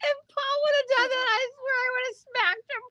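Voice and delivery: high voice squeezed by laughter; laughing while talking. Real, not acted. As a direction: talk through helpless giggles. Still laughing over the joke.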